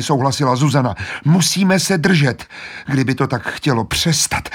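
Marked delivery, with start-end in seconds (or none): 1.2-4.5 strained, frightened. Acted. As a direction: hang on in fear. Susan holding on, strained. (Zuzana vyděšeně a napjatě)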